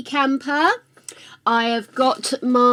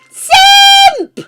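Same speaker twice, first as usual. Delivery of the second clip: high, loud gasp-shout; surprised shout; 13 dB louder than usual. Real, not acted. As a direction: gasp and yell a name in delight. Sudden shout at viewer.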